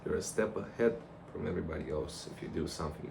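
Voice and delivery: ordinary delivery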